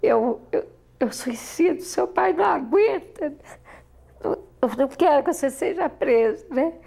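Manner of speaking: high, anxious pleading; pleading mother. Retold or imitated. Mother begging her daughter not to risk arrest, anxious and high.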